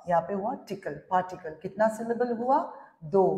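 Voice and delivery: ordinary talk, nothing marked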